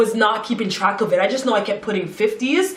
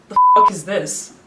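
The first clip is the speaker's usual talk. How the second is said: louder, drawn-out incredulous demand; retold outraged disbelief; 5 dB louder than usual. Confronting him over the short money, retold outrage. Retold shock at partial repayment.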